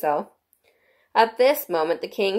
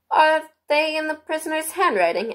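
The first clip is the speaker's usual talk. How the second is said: high juryman voice; 10 semitones higher than usual. A narrator's reading. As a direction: ask like a curious juror.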